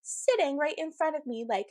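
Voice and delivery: ordinary talk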